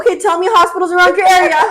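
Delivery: high, loud, quick prompting; talking to a child. Retold or imitated. Drilling a friend on where to apply, patronizing. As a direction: quiz her briskly like a child. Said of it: "spoke to her like a child".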